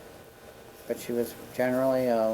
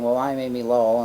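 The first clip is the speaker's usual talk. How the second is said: grand self-introduction; 7 dB louder than usual. Retold or imitated. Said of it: "she was very used to saying".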